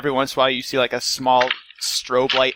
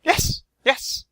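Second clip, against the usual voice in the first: Tabitha voice; 12 semitones higher than usual. Acted. NPC Tabitha answers.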